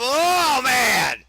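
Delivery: high, loud amazed shout; excited shout. Real, not acted. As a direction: yell it as the move lands. Reacting to a moonsault.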